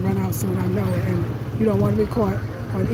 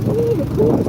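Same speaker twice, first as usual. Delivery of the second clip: high, louder cooing exclamation; mock delighted gasp; 13 semitones higher, 5 dB louder than usual. Re-enacting first arrival in the cool countryside, mock delight. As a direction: swoop way up in playful wonder.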